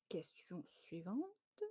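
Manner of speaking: whisper. Real, not acted. Whispered aside moving quiz on.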